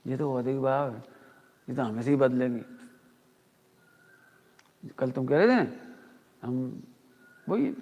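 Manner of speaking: normal talking voice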